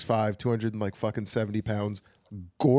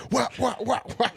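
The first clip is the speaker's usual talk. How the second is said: mock wailing; 12 semitones higher than usual. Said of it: "mock crying sounds".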